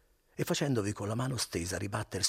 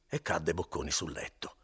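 plain reading voice

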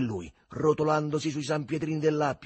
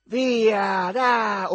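high, very loud heckle; shouting; 9 semitones higher, 9 dB louder than usual. A narrator's reading. Sprawled boys heckling passing couples, shouted jeer. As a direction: holler it at passers-by. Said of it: "quelli gridavano".

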